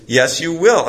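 insist, as if retelling a friendly argument; arguing insistence. Retold or imitated. Devotee reassures the guard about karma, insistent. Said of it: "He has an argument with him".

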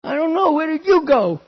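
put-on high, childlike wail; high pleading child voice; little lost boy. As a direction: voice yourself as a crying four-year-old. Child answers mother.

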